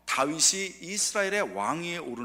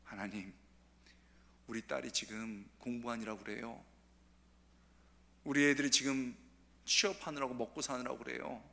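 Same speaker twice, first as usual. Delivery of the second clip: softer, lower pleading to God; soft dawn prayer; 4 semitones lower, 6 dB quieter than usual. Retold or imitated. Mother praying at dawn for her children, soft. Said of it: "우리 엄마들이 새벽에".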